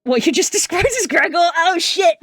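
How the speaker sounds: high, loud disbelief breaking into laughter; laughing shock. Real, not acted. Streamer realises their lore matches Gregor, high laughing shock. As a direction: cry out in amused surprise, voice shooting up.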